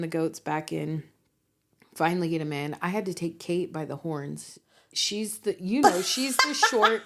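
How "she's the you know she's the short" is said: laughing. Real, not acted. Describing fat goat, laughing.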